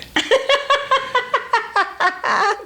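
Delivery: high shrieking laugh. Real, not acted. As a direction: burst into a squealing laugh. Laughs at gossip.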